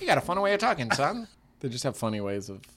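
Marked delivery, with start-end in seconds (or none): 0.1-1.3 put-on old banker voice. Old banker chiding a time traveler, higher and louder. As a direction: talk down to the boy like an old banker. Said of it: "old banker to Bill".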